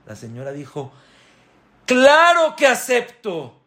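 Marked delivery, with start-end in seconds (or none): 1.8-3.6 very high, slow, loud yes; eager acceptance. Acted. Mother accepting the sage's terms, very high. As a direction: accept with all your heart.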